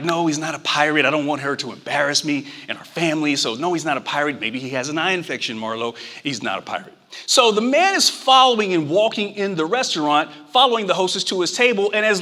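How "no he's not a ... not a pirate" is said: fast, fading to a low hushed whisper; embarrassed hush. Retold or imitated. Embarrassed dad hushes his daughter in public, getting quieter and faster. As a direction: shush the kid without being heard. Father hushing child, embarrassed.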